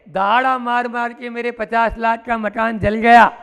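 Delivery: ordinary talk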